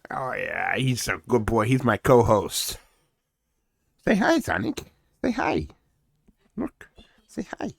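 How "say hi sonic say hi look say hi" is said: much higher coaxing puppy voice. Real, not acted. Host coaxing and voicing his dog Sonic, high. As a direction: coo at the dog.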